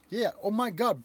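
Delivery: usual speaking voice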